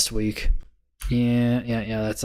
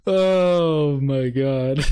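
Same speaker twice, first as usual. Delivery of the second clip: amazed; 3 semitones higher, 3 dB louder, much slower than usual. Real, not acted. Amazed at chart.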